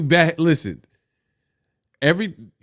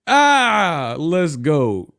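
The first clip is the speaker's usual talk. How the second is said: excited; 3 semitones higher, much slower than usual. Real, not acted. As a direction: burst out with excitement. Excited exclamation.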